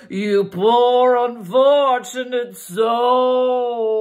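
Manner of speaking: slow, high, loud sea witch croon; Ursula impression. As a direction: drag it out like a hammed-up sea witch. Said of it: "And she'd go".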